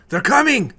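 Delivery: high loud alarmed shout; yell. Acted. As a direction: shout the warning down the hall. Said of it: "He yells".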